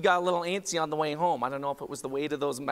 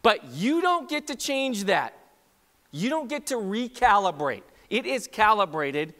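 raised pitch, forceful preacher; emphatic preaching; 5 semitones higher, 5 dB louder than usual. Real, not acted. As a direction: drive the point home to the congregation.

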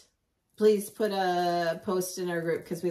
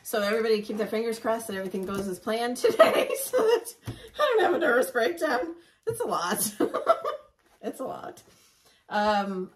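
laughing; 3 semitones higher, 4 dB louder than usual. Real, not acted. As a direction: laugh through the worry. Nervous laughing about the day.

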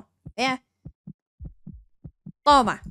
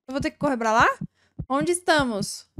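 usual speaking voice

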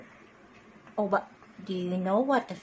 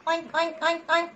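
high playful oinking; pig oinks; 9 semitones higher than usual. Shown as a demonstration. Teacher showing kids the pig, high oinking. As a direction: oink like a pig.

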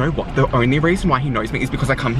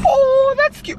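high, louder that's cute; delighted squeal; 4 dB louder, slower than usual. Real, not acted. Friend squeals at the smiley face drawn on the bag. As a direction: coo over it in delight.

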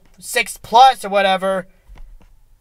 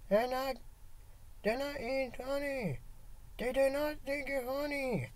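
quiet; 3 semitones higher, 21 dB quieter than usual. Acted. Sung near-silent chant.

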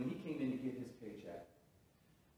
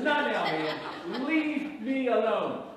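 loud, slow, higher, half laughing; exasperated; 8 semitones higher, 10 dB louder, much slower than usual. Retold or imitated. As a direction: drag it out, pushing him away. Retold angry brush-off.

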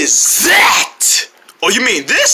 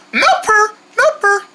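high echoed brand name; sing-song it higher each time; 9 semitones higher than usual. Acted.

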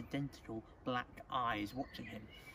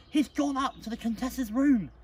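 high, loud, alarmed blurting; urgent outburst; 11 semitones higher, 10 dB louder than usual. Acted. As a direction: blurt it out before they stop you. Child blurts out alarming news.

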